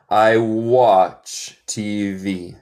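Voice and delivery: slow, careful teacher demo; slow model sentence. Shown as a demonstration. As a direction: stretch it out for learners. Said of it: "teacher models slow sentence".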